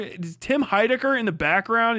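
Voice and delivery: ordinary talk, nothing marked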